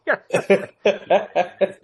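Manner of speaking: loud burst of laughter; hearty laugh. Real, not acted. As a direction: laugh out loud at your own joke. Laughs at own Irish joke.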